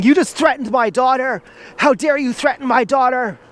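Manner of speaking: high, indignant imitation of an angry parent; put-on high outrage at the door; mock outraged mother.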